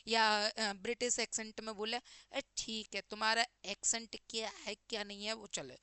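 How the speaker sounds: usual speaking voice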